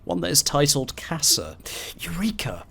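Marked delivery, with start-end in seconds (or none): 2.0-2.6 breathless whispered triumph; whispered eureka. Acted. Scientist whispering to himself over a breakthrough. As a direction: whisper it like a secret discovery.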